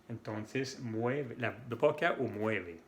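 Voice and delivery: usual speaking voice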